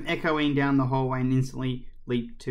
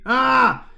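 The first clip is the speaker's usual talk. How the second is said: high, loud shriek of fright; startled scream; 11 semitones higher, 10 dB louder than usual. A narrator's reading. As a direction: scream as if someone appeared behind you. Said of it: "she screamed and leaped back".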